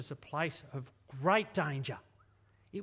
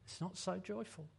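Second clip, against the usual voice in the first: soft downcast complaint; 8 dB quieter than usual. Acted. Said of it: "standing back and going".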